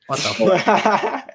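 slow laughing What the fuck; drawn-out laugh through the words; laughing disbelief. Real, not acted. Laughing disbelief after quote.

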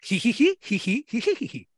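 wheezy cartoon dog snicker; Scooby-Doo laugh. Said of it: "comme ça que Scooby-Doo rit". (rire de Scooby-Doo)

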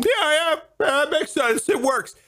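high, whiny mock agreement; mocking approval. Mocking everyone's acceptance of fake accents.

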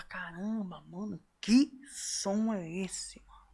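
slow hushed whisper of wonder; awed whisper. Retold or imitated. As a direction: breathe it out in amazement. Awed reaction to hearing a song.

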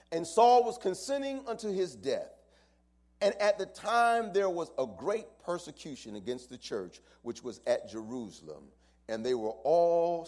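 loud, high preaching cry; preaching at full force. Acted. Pastor reading Acts to the church, preaching at full force. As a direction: raise it up and preach it hard.

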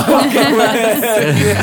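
high, loud okay through a laugh; giggling mock agreement. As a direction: laugh out the okay as if amused at him. Parents' amused reaction to son.

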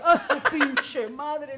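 loud, slow, laughing panicked cry; mock panic. Showing the hosts her scorpion panic, a laughing shriek. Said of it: "es así de".

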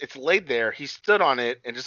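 ordinary talk

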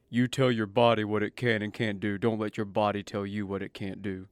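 low-pitched imitation of a coach; horrible trainer impersonation. Trainee quoting his trainer's typical response, low mock-coach voice. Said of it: "That was a horrible impersonation".

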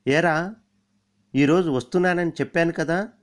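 loud mock-angry reproach over the phone; bullying scold. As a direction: lean on him like an irritated old pal. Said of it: "దబాయించాడు".

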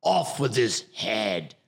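slow, hammy English pirate; pirate English accent. Shown as a demonstration. Actor finishes his audition demo, slow pirate English accent. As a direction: draw out the pirate order in a put-on English accent. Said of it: "what a great English accent".

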